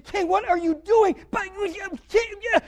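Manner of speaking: high, sputtering mock outrage; mock protest. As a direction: act out an indignant complaint, breaking into splutters. Imagined protest to the king.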